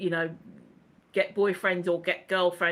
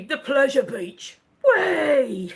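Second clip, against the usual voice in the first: louder, higher hurrah; mock cheer; 3 semitones higher, 5 dB louder, much slower than usual. Host cheering Jimmy's mention of the pleasure beach, mock excitement. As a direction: cheer it out playfully.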